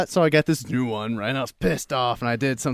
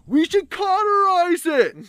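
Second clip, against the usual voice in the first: very high squeaky call; voice from the back. Retold or imitated. Drunk friend in the back of the room suggesting, high mock call. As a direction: pipe up in a put-on high voice with a bad idea.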